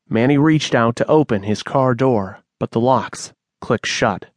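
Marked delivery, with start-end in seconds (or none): none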